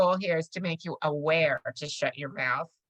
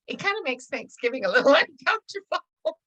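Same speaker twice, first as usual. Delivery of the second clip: laughing retelling; 9 semitones higher, 3 dB louder than usual. Retold or imitated. Friend's deadpan line, teller laughing.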